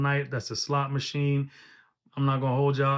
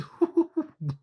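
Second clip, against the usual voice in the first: high burst of laughter; 14 semitones higher than usual. Real, not acted. Reacting to a wild bar.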